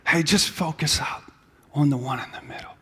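soft, low, breathy whisper in the ear; whispered advice. Retold or imitated. A friend in the corner whispering to focus, hushed. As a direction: lean in and whisper it.

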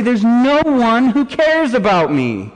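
high, drawn-out cry of abandonment; anguished cry out. Acted. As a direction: cry it out high and slowly, falling away at the end. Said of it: "to cry out those words".

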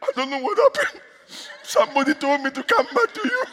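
high-pitched mock crying voice; imitated tearful husband. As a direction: tell it as a bewildered, weepy man. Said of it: "He cried and came back".

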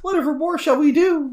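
put-on high, theatrical old-fashioned wail; melodramatic lament. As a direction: ham up a damsel in distress. Hammed-up dramatic lament.

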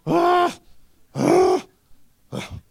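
high, loud breathy ah sounds; imitating the sound of tongues; mock tongues. Preacher imitates his own tongues-speaking, high and loud.

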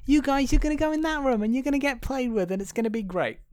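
high, sweetly scheming voice; oily friendly toys. Retold or imitated. Host voices the Sunnyside toys assigning rooms, high and sweet. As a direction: sell it high and syrupy. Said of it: "And so they like".

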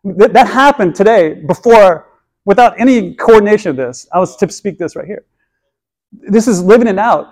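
rally the congregation to believe; fervent preaching. Acted. Exhorts congregation at full force.

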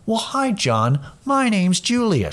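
slow, sweet, rising greeting; talking to a child. Retold or imitated. Counter woman greeting a customer by name, as to a child. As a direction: imitate a children's show host saying hello. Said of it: "like she's talking to a child".

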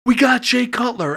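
high, thrilled announcement; excited mock scream. Father telling his son the big news, excitedly screaming in retelling. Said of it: "I start screaming".

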